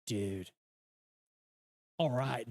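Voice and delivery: a light mock Californian Dude; Californian accent. Shown as a demonstration. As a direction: lay on a laid-back Californian dude. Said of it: "saying dude a lot".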